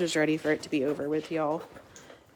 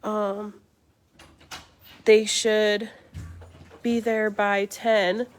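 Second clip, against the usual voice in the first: higher, slow, unsure answer; a hint of a vague official stalling; lightly voiced hesitant lady; 4 semitones higher, much slower than usual. Mold lady on the phone, lightly mimicked stalling. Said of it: "she was like".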